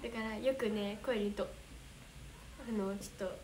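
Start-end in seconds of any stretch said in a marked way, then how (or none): none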